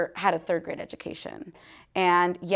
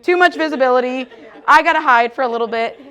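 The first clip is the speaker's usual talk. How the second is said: louder, fast, laughing mock panic; 4 semitones higher, 11 dB louder, faster than usual. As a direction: rush it like hiding away. Quoting her own panic.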